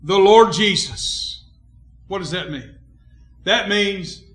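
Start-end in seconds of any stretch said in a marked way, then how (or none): 0.0-1.4 slow, loud, weighty Bible reading; emphatic scripture reading. Real, not acted. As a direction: proclaim each word of the verse slowly. Reads scripture at full force.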